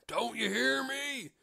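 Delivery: slow, high, loud bellow at a deaf ear; shouting. A narrator's reading. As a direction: yell it out slowly so she can hear. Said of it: "The innkeeper shouted his loudest".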